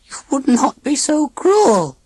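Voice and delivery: slow, pleading, emotional take; with human emotion. A narrator's reading. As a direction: plead it with real feeling. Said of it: "giving her voice human stress and emotions".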